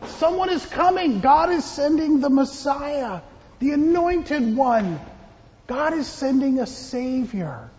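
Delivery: high-pitched proclamation to the crowd; preaching. Acted. John the Baptist telling everybody the Messiah is coming, high preaching voice. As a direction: announce it to everybody, pitched well up. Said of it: "he was preaching and telling everybody".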